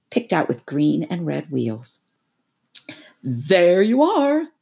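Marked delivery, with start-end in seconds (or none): 3.2-4.5 slow, loud, higher showman's cry; proud toad crying out; excited cry. Acted. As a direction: unveil the caravan with a flourish. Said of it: "cried the toad".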